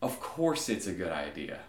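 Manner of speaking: low, unimpressed deity; God. Acted. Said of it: "something I could hear God saying now".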